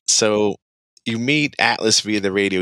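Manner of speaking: ordinary talk, nothing marked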